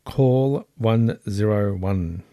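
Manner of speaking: spelling it out slowly for Siri; slow, deliberate voice command; slow clear command. Real, not acted.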